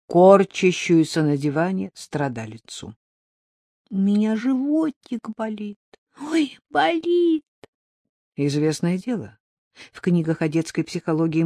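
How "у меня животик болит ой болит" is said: put-on high whining little girl voice; whiny sick child. Child complaining of tummy ache.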